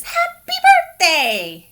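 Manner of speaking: high, laughing "Happy birthday"; laughing quote. Acted. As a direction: sing out a birthday wish through a laugh. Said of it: "He says".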